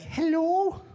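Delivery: mock Mrs Doubtfire hello. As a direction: answer the phone like a sweet old Scottish lady. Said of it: "my mom sounds like Missus Doubtfire".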